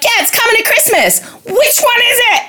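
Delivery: high, airy put-on voice of a dim leader; clueless mock voice. Host mocks the prime minister's clueless reply, high and airy. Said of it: "he's like".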